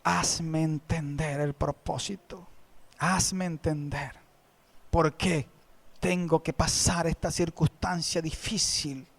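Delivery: soft, slow, low pleading prayer; hushed, weeping plea to the Lord; tearful prayer. Retold or imitated. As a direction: plead quietly through tears. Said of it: "yo le he llorado a mi Señor".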